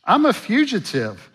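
a touch of Moses pleading, pitch rising; lightly voiced Moses. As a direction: mildly protest being a fugitive. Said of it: "That's essentially what he's saying".

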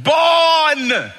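high, loud drawn-out yell; retold yelling. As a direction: holler one long word. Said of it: "so we started yelling".

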